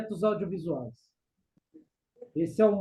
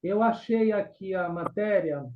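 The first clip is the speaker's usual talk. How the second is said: normal talking voice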